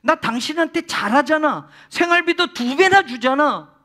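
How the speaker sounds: pleading you know I treat you well; husband's self-justifying reassurance. Retold or imitated. Husband to weeping wife, loudly insisting he provides. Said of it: "이렇게 말하는 거예요".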